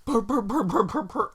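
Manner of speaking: high mock purr of tires sliding; skidding tire noise. Driver imitating the van sliding on bald tires, high purring.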